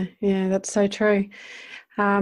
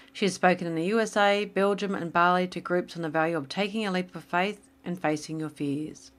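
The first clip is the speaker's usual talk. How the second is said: a softer, measured podcast intro read; 3 semitones lower, 7 dB quieter than usual. Acted. Host introducing guest Angela to listeners, smooth announcer read. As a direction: read the guest's bio like a polished show intro.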